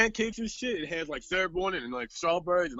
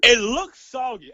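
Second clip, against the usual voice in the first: slow, louder imitation of her text; mock her verdict with relish; slowed, louder quoted reply; mock quote; 4 semitones higher, 8 dB louder, slower than usual. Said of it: "that's another goddamn quotable".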